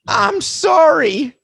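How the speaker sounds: hammed-up sorry; whiny mock apology. Mocking the repeated apology.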